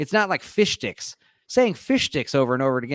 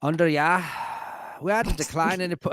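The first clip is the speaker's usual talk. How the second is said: Northern English turning Irish, quicker; Irish accent; 3 semitones higher than usual. Pretend. Reading a headline, accent turns Irish. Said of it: "I said Irish".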